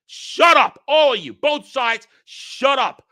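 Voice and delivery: high, loud angry command; fed-up shout. Real, not acted. Commentator silencing both sides, exasperated shout. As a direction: yell both camps down.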